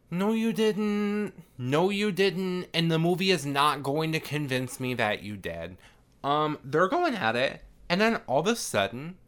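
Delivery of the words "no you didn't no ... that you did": emphatic high-pitched rebuttal; scoffing contradiction. Real, not acted. Speaker scoffs back at the character.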